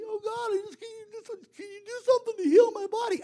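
high, thin begging voice; imitating a helpless, whimpering prayer; mock whiny pleading. Mimics a person pleading with God.

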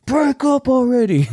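high, strained mock shout; imitated neighbors' yell. Neighbors pounding on the wall, imitated high yell. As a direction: holler it high, as through a wall. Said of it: "They'd be yelling".

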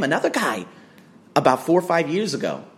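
normal talking voice

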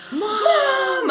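very high, contained mock yell; squealed call, not at full volume; retold yell for Mom. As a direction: imitate a panicked kid calling for help. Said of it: "I start yelling for Mom".

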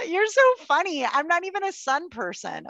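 put-on high, giggly, flattering girly tone; good time girl voice. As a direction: laugh it off sweetly. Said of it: "The second way is".

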